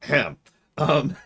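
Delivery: ordinary delivery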